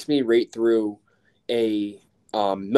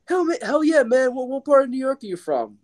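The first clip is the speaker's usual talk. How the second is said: very high, thrilled cop voice; cartoonishly high cop asking where you're from; imitating the officer. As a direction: crank the pitch way up as the excited officer. Cop friendly at checkpoint.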